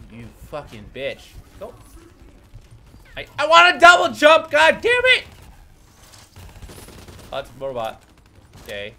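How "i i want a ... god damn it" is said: loud, high, furious shout; frustrated shout. Real, not acted. Player raging at the game for no double jump, shouting. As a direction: bellow it out in frustration.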